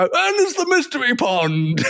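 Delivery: high-pitched comic catchphrase; mock TV announcement. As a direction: pop up with a silly high announcement. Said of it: "they come back and they go".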